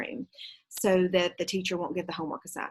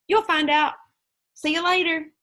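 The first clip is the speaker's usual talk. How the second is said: put-on much higher, louder teasing boy; grinning; 9 semitones higher, 7 dB louder than usual. Nick teasing Janet at the corner, grinning. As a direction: tease her with a grin. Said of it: "Nick grinned and said".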